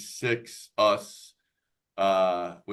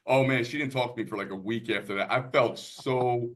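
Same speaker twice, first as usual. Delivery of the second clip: ordinary talk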